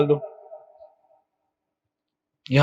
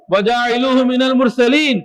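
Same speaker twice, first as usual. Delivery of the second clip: ordinary talk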